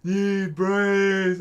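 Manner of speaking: groaning zombie moaning for brains. Pretend. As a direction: moan it like a shambling zombie. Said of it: "I'm just gonna make zombie sounds at you".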